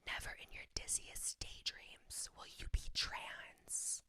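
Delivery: breathy mocking whisper, slow and close to the mic; mock ASMR whisper. Mocking Jeffrey with his own ASMR whisper, slow and hushed. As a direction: whisper it like a parody of his soft ASMR videos. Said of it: "I'm gonna do it even in his A S M R voice".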